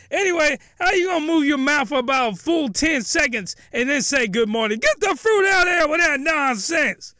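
high-pitched, exasperated comic tirade; mock outraged rant. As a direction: rant in hammed-up indignation. Ranting at badly dubbed mouth movements.

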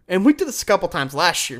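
normal talking voice

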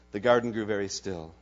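ordinary delivery

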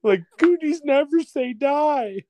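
high-pitched weepy triumph; mock tearful. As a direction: retell it in a squeaky, choked-up wail. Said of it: "I started crying too".

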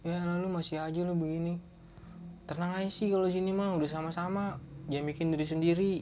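calm him down while rolling your eyes; a hint of tough-love comforting; coaxing friend. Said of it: "Gua bilang gitu".

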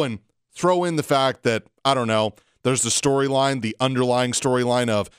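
ordinary talk, nothing marked

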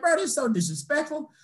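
high-pitched, incredulous complaint; indignant storyteller's reenactment; retold outrage. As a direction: protest in high-voiced disbelief. Retold outrage at thrown water.

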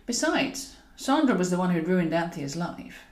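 plain reading voice

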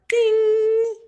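high, loud ringing ding; phone ding. Retold or imitated. Host mimics the perfectly timed alert, high ringing ding. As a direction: sing out a held alert tone.

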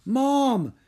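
high, loud urgent cry. A narrator's reading. Distressed son to his mother, crying out. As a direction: burst out to get her attention. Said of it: "Drake cried".

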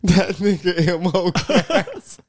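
laughing through the words, slow and high. Retold or imitated. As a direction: crack up while saying it.

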